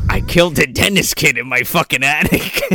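put-on grumpy old man; hammed-up character voice; Walter Matthau. Riffing on the attic, put-on Matthau voice. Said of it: "is Walter Matthau in the fucking house".

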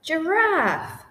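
loud high answer shout. Acted. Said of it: "We all shouted".

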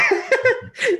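high-pitched laughter. Real, not acted. Laughing at the co-host's app idea.

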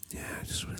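breathy ASMR whisper. Shown as a demonstration. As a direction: hush it into the mic. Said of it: "I talk very softly like this".

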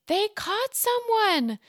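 high, slow panicked gasp of a thought. A narrator's reading. Maria panicking as she spots the captive. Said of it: "she thought, panicked".

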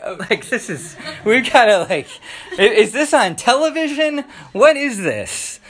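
high, laughing mock horror; embarrassed laughing disbelief. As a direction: squeal through a laugh as if mortified on TV. Imagined embarrassment watching family on TV.

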